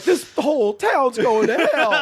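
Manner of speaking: high, slowed complaint, laughing; mock outraged local. Friend imitating an offended mother, laughing. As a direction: grumble like a scandalized resident. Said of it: "they were just like".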